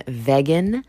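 "vegan" said as VEG-an; imitated pronunciation. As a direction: rhyme the first syllable of "vegan" with "wedge". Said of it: "She calls herself a vegan".

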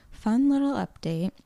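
ordinary talk, nothing marked

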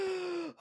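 high, startled intake of breath; sharp gasp. Real, not acted. Watching the creature grab him, a startled gasp. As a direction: gasp in sudden shock. Creature grabs the man on screen.

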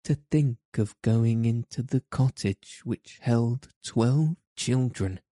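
usual speaking voice

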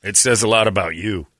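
normal talking voice

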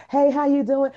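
bright upbeat hey with a rising end; reflex greeting. Retold or imitated. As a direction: toss off the greeting on autopilot. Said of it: "fucking reflex".

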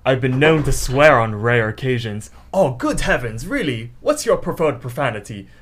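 hammed-up British politeness; mock British accent. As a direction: parody a very proper British speaker. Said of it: "that video was made in the U K".

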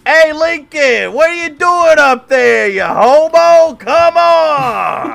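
put-on very high, loud, slow heckling shout of a drunk hooligan; Irish hooligan heckler. Time-traveling hooligan heckling Lincoln at the debate, shrill shouting. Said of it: "Irish hooligans. Be like".

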